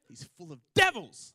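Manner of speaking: sudden loud burst on devils; frantic outburst. Retold or imitated. Frantic old woman denouncing a man over there, outburst. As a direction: blurt it out, exploding on the last word. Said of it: "like that".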